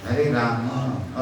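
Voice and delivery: slow राम with the m opened into a full a; full final vowel. Shown as a demonstration. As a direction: draw out राम as raa-ma. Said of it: "म में पूरा अ आना चाहिए". (राम में म का पूरा अ, खींचकर)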